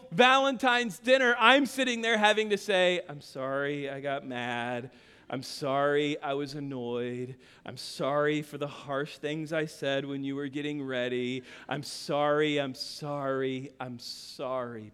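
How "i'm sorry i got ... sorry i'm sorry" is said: low, slow, soft apologies; contrite apology. Retold or imitated. Husband apologizes to wife at ruined dinner, subdued and contrite. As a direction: repeat it heavily and quietly, sinking lower.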